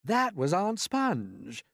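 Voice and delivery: slow, stretched cheer with a rising end; gleeful shout. Acted. Slow whooping centipede shout. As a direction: centipede whooping as the peach bumps. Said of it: "the centipede had shouted".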